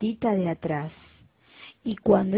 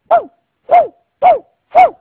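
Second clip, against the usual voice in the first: ladridos muy agudos y fuertes; ladrido de cachorro; 13 dB louder than usual. Acted. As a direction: ladra como un cachorrito excitado. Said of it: "empezó a ladrar". (el cachorrito le ladra al gato, ladrido agudo)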